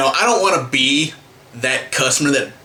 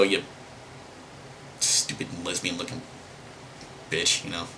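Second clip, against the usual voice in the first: much softer and lower, under the breath; muttered insult; 7 semitones lower, 13 dB quieter than usual. Real, not acted. Cursing the clerk to viewers under his breath. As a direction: drop the voice to a low aside.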